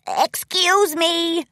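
high, drawn-out call. A narrator's reading. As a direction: call out to get through a crowd. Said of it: "he called out".

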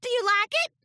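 high eager filly voice; hopeful filly. Acted. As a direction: ask hopefully for approval. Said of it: "asked Apple Bloom".

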